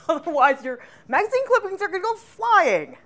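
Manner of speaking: laughing while explaining. Real, not acted. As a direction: chuckle through the warning. Laughs at clippings flying away.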